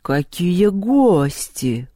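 very slow, stretched-out exclamation; drawn-out words. A narrator's reading. Перун seeing Люба as his guest, drawling. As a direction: drag out each vowel in astonishment. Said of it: "протянул он".

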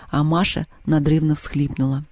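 ordinary delivery, nothing marked

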